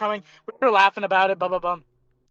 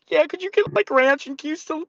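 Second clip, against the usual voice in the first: high, strained teary request; mock pleading; 5 semitones higher than usual. Dumped guy begging the waiter through tears, high and strained. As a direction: whine it like you're about to cry.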